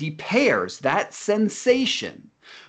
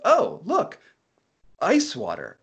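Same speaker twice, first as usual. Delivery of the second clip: much higher, bright-eyed recognition of ice water; chirpy librarian; 9 semitones higher, slower than usual. Retold or imitated. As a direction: play the librarian spotting a familiar book, up high. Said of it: "She's like".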